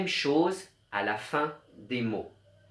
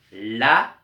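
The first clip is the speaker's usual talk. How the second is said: la said much louder; stressed la; 10 dB louder than usual. Shown as a demonstration. Teacher showing the rhyming sound, la said loud. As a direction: hit the syllable la. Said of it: "on entend".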